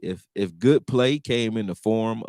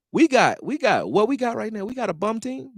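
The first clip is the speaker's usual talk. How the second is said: incredulous; 13 semitones higher, 4 dB louder than usual. Real, not acted. Incredulous question to chat.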